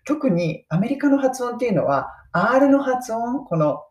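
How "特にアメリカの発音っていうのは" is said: ordinary talk, nothing marked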